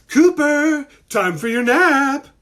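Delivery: high, loud mom call. A narrator's reading. Mom summoning Cooper for his nap, calling out. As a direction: call a child from another room, drawn out. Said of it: "called their mom".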